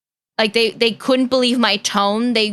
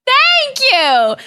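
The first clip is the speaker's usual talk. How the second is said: excited thanks; 13 semitones higher, 4 dB louder than usual. Real, not acted. Thanking chat donation.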